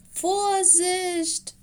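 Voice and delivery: high, loud shout of alarm; young wolf yells out a warning; loud warning cry. Acted. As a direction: shout a sudden warning into the laughter. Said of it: "rief Mino laut".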